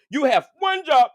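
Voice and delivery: loud, high scolding shout. Real, not acted. Scolding the girl about listening, shouted. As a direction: bark the rule at them.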